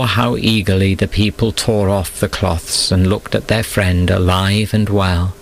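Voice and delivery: usual speaking voice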